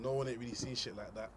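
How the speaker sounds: usual speaking voice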